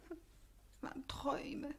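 whispered resignation; fading whisper. Acted. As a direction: let it die away.

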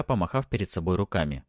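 usual speaking voice

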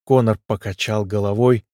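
ordinary delivery

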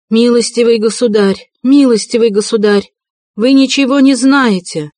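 high, loud desperate shout. A narrator's reading. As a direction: cry out over the crowd. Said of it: "кричала Катерина Ивановна".

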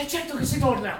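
high, loud answer called back; shouted reply. Acted. Men answer the father, shouting high. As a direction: call back across the field.

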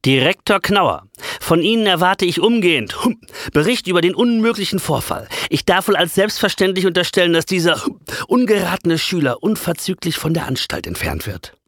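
stern inspector. Acted. School inspector orders the director to expel the pupil, pompous and loud. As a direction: deliver a stiff dignified reprimand.

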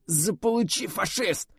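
high strained cry; dying defiant cry. A narrator's reading. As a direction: spit out a last curse, high. Dying soldier's last curse.

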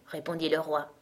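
ordinary delivery

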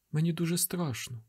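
put-on high soft child's fear; frightened boy, hushed; child confessing fear quietly; scared boy. A narrator's reading.

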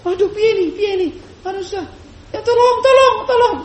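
high, flustered cries for help; panicked, nervous. Acted. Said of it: "Anaknya Tasilahu sudah bingung, gugup".

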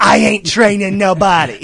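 very loud, high comic bellow; over-the-top shouted refusal; hammed-up furious refusal. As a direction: bellow it like an outraged master. Master's refusal replayed, exaggerated.

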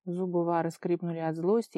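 ordinary delivery

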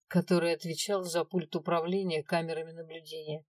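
ordinary delivery, nothing marked

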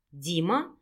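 low, rising surprised question. A narrator's reading. Man hears a familiar name from the caller, surprised. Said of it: "удивлённо повторил мужчина".

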